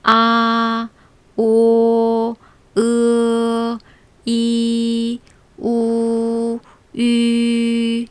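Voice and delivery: 아 오 으 이 우 유 each said clear and held; Chinese vowel drill. Shown as a demonstration. Teacher drilling six vowels aloud, clear model. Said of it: "자, 그럼 처음부터".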